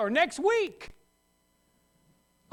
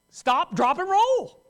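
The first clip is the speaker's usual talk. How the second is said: loud mock alarm; 7 semitones higher, 8 dB louder, much slower than usual. Acting out fire drill.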